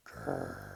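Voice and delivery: very low, soft rumbling growl. Acted. Narrator voicing the mystery sound, a low growl. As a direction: rumble it deep like a bear's belly.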